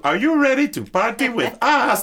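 high, loud hype shout; party hype. Retold or imitated. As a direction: call out to the crowd. Quoting the singer's hype line.

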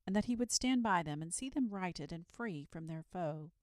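ordinary delivery

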